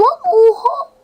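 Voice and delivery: stunned outburst; shocked yelp. Real, not acted. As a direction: blurt it in disbelief. Shocked at game result.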